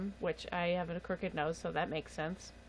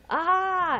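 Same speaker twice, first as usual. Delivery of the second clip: retold pained cry; 10 semitones higher, 8 dB louder than usual. Needle stuck in her head.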